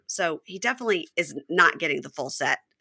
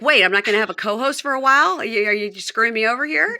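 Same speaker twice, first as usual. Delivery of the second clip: mock indignation; 5 semitones higher, 4 dB louder than usual. Acting out co-host's alarmed protest.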